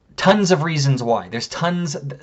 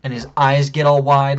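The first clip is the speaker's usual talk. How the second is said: ordinary delivery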